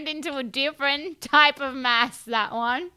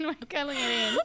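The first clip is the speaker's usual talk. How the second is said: laughing repeat; much faster than usual. Pretend. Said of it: "Maybe we're like".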